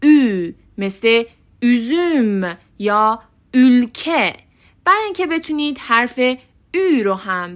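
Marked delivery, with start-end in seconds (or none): 0.0-4.4 "üzüm" and "ülke" slowly with fronted ü; dotted Ü examples. Shown as a demonstration. Teacher giving examples, "ülke" slow with long ü. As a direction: stretch "üzüm" with rounded lips. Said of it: "روش دوتا نقطه گذاشته".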